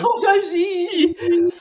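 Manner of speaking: exaggerated French accent with a whimper; mock French accent. Shown as a demonstration. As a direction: whine a cartoon French bonjour. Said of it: "mais non".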